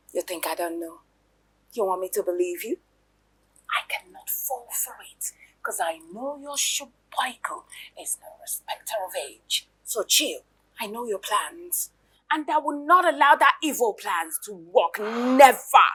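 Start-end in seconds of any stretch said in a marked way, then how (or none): 3.7-9.7 whisper. Acted. Hissed accusation. 15.0-15.9 defiant. Acted. Defiant refusal.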